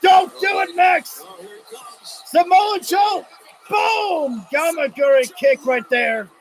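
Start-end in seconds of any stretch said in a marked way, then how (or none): 0.0-1.2 shouting at the screen, don't do it; desperate plea. Real, not acted. Begging a wrestler not to dive. 2.2-4.4 high, slow shouted call; live hype call; excited call. Real, not acted. Calling a big kick.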